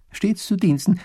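high, loud, eager reply; thin little clerk, high voice; servile scribe. A narrator's reading. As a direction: answer like an obsequious servant.

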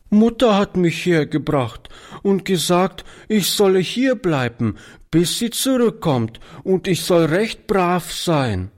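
put-on small high child voice, lightly tearful; understated sob of a little girl; mildly tearful. A narrator's reading. Little girl left in the shop by her mother, mildly tearful. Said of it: "erklärte die Kleine schluchzend".

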